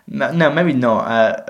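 ordinary delivery

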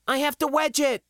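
high, urgent call. Acted. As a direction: call back while struggling with a repair. She calls while fixing breaker.

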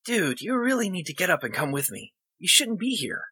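put-on high young boy voice; high boyish urging; boy's voice. Boy character voiced in story.

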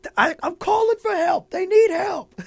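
high, loud mock shout for help; mock sideline plea. Host re-enacting Tyreek Hill's sideline plea, high mock yell. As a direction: yelp it like a player begging the sideline. Mimics player calling from sideline.

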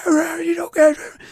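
mock classroom yell; imitated teacher yell. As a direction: bellow at the class like a furious teacher. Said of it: "you know, yelling".

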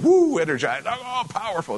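hammed-up hype-man cheer, pitch rising; mock the pumped-up, showy hype. Mocking a hyped-up preacher.

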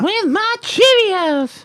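high, whining call to Mom; whiny kid yelling. Pretend. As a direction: whine up the stairs like a spoiled kid.

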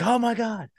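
sudden shocked realization; high, shocked cry; horrified. Retold or imitated. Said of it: "And I was like".

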